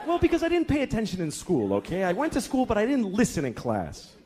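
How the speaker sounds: high-pitched, exasperated confession; defensive father. Acted. Father explaining himself to his daughter, exasperated. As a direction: blurt it out, cornered and defensive.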